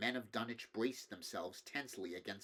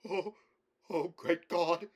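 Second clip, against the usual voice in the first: slow, strained, higher moaning cry; a man undone by what he saw; terrified moan; 7 semitones higher, 8 dB louder, slower than usual. A narrator's reading. Shaken farmer moaning to the crowd in terror. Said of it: "moan half inaudibly".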